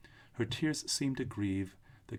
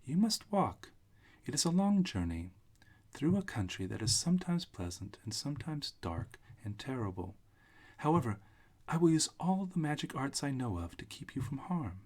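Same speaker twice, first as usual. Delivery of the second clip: put-on little old witch; 6 semitones higher than usual. Witch figure, high voice.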